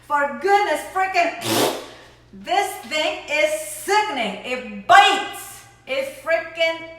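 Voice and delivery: loud, high, slow disgust; disgusted outburst. Real, not acted. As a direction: cry out at a foul taste. Reacts to a disgusting taste.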